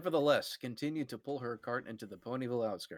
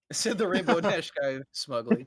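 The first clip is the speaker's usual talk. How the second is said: laughing; 4 semitones higher, 4 dB louder than usual. Real, not acted.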